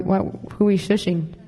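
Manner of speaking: normal talking voice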